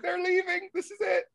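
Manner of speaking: very high, hammed-up heartbreak; mock tearful. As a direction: relive a teen's distress at the film's ending. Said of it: "I definitely cried".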